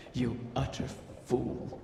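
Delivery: scornful whisper. Acted. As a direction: spit it out under your breath. Riven scorns the dying dragon.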